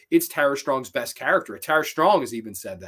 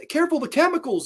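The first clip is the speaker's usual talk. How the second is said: high, urgent call as Robin; Robin's warning; 3 dB louder, faster than usual. Retold or imitated. Robin warning Beast Boy near the chemical vat, alarmed. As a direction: shout a quick warning up high.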